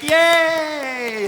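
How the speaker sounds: high, loud mock cheer; cheering yay. Crowd cheering at a nuked song, excited. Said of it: "everyone's like".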